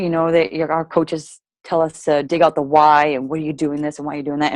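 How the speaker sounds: normal talking voice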